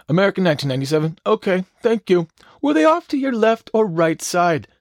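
high, fast, strained radio voice; mock tower controller. Tower answering the pilot about the jetpack, high and fast. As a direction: camp up the controller's calm reply. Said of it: "And the tower goes".

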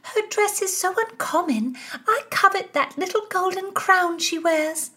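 put-on very high girlish chirp; piping young girl. A narrator's reading. Little Anna gushes to the ladies about the princess, high and piping. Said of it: "Anna Windsor piped in".

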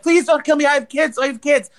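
high-pitched mock begging for mercy; imitated hysterical pleading. Woman on the floor begging the robber, mock hysterical. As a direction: act out a panicked plea, without real sobs. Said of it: "crying hysterically".

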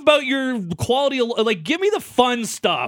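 loud, high, demanding the fun; excited insistence. Real, not acted. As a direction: push hard for the good stuff. Demanding fun lottery dreams.

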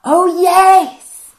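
high, loud delighted exclamation; light up with sudden loud delight; excited. Real, not acted. Co-host remembering the Nautilus meet and greet, excited. Delighted response to new topic.

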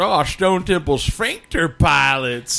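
high, stretched-out jeering quote; mocking heckle. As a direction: draw out the joke name in a high mock voice. Other guy mocks the band's name.

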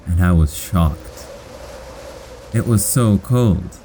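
plain reading voice

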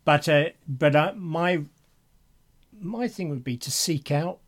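ordinary talk

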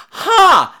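high, sharp "ha"; mock exclamation. Teller jokes about scaring people, sharp exclamation. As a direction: burst out with a sudden high cry. Scare noise or scoff.

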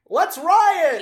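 high, loud mocking rallying cry; mock mob cry. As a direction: yell it like a dumb mob. Mice mocked as riot mob.

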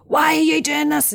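high, exasperated mock yell; a furious parent faced with muddy floors, lightly acted; apoplectic parent. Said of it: "you're absolutely apoplectic".

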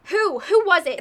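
put-on hurt girlfriend loudly demands answers; demanding outburst. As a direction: demand an answer from your partner. Demands name of the cheater.

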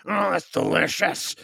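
high, gloating relish; a greedy creature's delighted purr; monster savoring. Acted. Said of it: "And he goes".

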